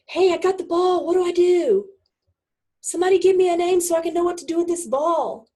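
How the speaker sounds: high, loud mock plea to the crowd; calling for help. Player on the field begging the fans for guidance, calling out. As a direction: call up to the stands, hammed-up.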